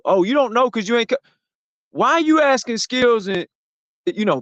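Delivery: usual speaking voice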